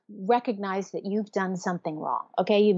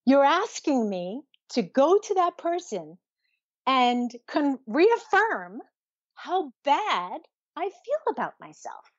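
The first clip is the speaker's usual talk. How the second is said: higher, emphatic protest; pained protest; 5 semitones higher than usual. Acted. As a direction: protest an unbearable demand, stretching the key words. Acting her younger self protesting to mother.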